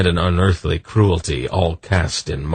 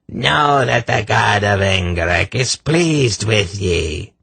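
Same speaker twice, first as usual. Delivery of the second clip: shrill madman; 4 semitones higher than usual. A narrator's reading. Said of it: "in his shrill voice".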